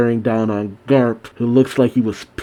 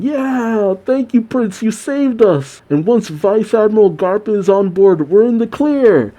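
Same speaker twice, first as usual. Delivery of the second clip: high-pitched imitation of a jubilant crowd; cheering Marines; 9 semitones higher than usual. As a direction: cheer in mock crowd voices, drawn up high. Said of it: "the Marines are cheering".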